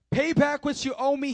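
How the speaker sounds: loud, high-pitched demand for repayment. A narrator's reading. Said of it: "he demanded".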